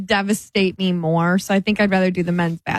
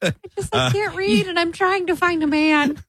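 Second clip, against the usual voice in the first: higher, silly ditzy voice; playing dumb; 8 semitones higher than usual. Joking with friends about mannequins, a high ditzy voice. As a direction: play a clueless lady, pitched up. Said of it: "You have a gal?".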